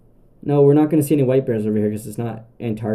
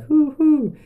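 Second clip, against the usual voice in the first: high hooting owl call; 4 dB louder than usual. Retold or imitated. Telling campers about night sounds, high owl hoot. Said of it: "hearing owls go".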